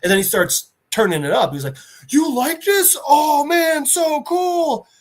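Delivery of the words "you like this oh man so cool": high-pitched delighted gushing; high, giddy enthusiasm; excited. Acted. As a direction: gush like a thrilled fan, pitched way up. Peacemaker excited gorilla likes his music.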